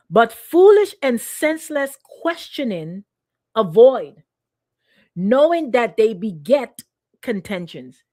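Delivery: slow, loud emphatic reading; slow preacher reading of scripture. Real, not acted. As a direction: read the verse with weight. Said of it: "here's what it says".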